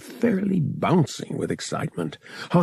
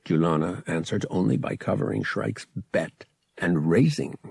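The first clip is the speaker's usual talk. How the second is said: ordinary delivery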